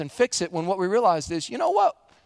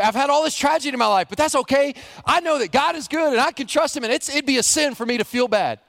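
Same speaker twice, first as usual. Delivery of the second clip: mock brave, higher and faster; fake cheerful; 8 semitones higher than usual. [name] pretending all is fine, in fake cheer. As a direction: chirp it like a forced smile. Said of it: "put on a happy face and say".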